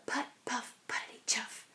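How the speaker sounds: faint whispered engine noise; whispered putt-putt. A narrator's reading. Narrator sounding the distant tractor, whispered chug.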